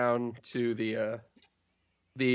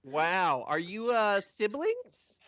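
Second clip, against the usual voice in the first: higher, wondering voice; lightly played amazed clerk; starstruck admin; 8 semitones higher, slower than usual.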